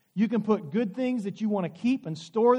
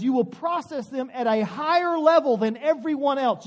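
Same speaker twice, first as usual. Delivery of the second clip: a slow, loud preacher's cry, higher in pitch; preaching; 5 semitones higher, 8 dB louder than usual. Acted. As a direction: preach it out with force to the congregation. Preaching Jesus' words.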